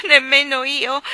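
put-on loud wailing child voice; a child crying out in protest. A narrator's reading. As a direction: wail it into the pillow. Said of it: "piangeva Barbara".

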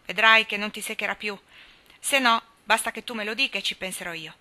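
usual speaking voice

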